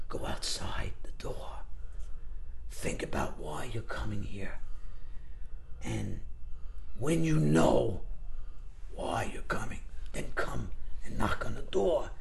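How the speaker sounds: slow, whispered instructions; low, intense near-whisper; hushed acting teacher. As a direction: imitate a coach directing very softly, with long pauses. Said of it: "one time he'd say".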